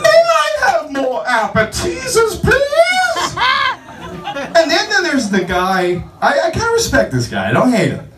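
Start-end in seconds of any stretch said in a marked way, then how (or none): 0.0-3.4 warble it like a nanny in drag; Mrs. Doubtfire. Retold or imitated. Disguised guest asking waiter for more, high falsetto. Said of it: "She sounded like Missus Doubtfire".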